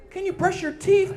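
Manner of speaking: woman's voice. Retold or imitated.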